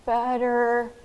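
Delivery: halting, slow. Shown as a demonstration. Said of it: "you shouldn't need to say".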